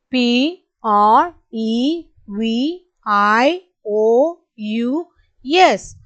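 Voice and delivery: each letter slow and spaced; slow spelling. Shown as a demonstration. Teacher spelling slowly for the class. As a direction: spell slowly so kids can follow. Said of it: "repeat along with me".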